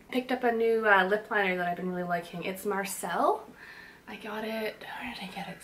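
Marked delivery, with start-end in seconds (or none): 4.1-5.6 unvoiced whisper to herself. Real, not acted. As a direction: whisper it, trying to remember. Thinking aloud while applying makeup.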